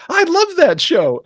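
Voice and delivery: high, slow, gushing voice; gushing fans. Retold or imitated. Table mates chiming in, high, slow gushing. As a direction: imitate the whole table gushing. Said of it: "everyone at the table starts kicking in with".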